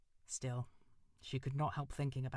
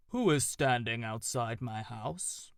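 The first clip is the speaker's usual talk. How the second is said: slow, louder round owl voice booming from a tree; deep round owl voice; 7 dB louder, slower than usual. Acted. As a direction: boom it out slow like an organ tone. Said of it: "a deep round voice, a voice like an organ tone".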